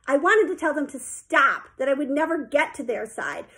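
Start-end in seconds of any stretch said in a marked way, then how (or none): none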